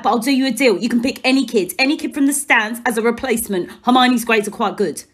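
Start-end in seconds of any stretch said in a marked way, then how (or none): none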